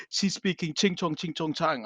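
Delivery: hint of a mocking sing-song; mocking passers-by. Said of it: "some people passing by, and they're like".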